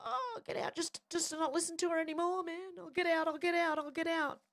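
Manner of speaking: hammed-up high whiny get out; mock fleeing man. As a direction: play the harassed man caving in. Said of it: "my voice will go so high that they'll be".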